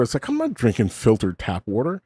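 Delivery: ordinary delivery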